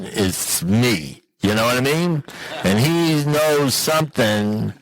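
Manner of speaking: ordinary talk, nothing marked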